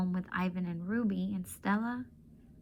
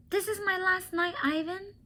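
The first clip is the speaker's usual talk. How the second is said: put-on much higher girlish voice, tender and sad; a girl saying goodbye to her friend; young girl Julia; 11 semitones higher than usual.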